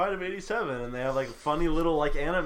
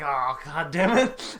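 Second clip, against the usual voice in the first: frustrated groan; 5 dB louder, slower than usual. Retold or imitated. Acting out player's frustrated reaction.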